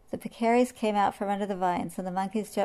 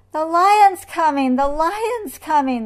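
high, loud, drawn-out alarm call; crying out; 9 semitones higher, 10 dB louder, slower than usual. A narrator's reading. Peccaries warning the animals of the lion, crying out. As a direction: cry the warning out slowly and loudly. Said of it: "they cried".

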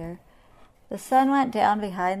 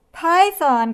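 high, loud, falling exclamation; surprised cry; 10 semitones higher, 12 dB louder than usual. A narrator's reading. Everyone discovers who scared the lion, amazed cry. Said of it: "cried everyone".

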